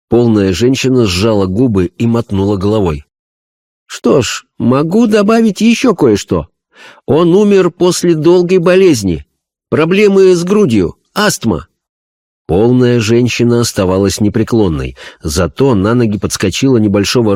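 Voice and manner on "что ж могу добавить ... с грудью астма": high, earnest medium voice; a spirit medium describing a vision; seance medium. Acted. (голос медиума на сеансе)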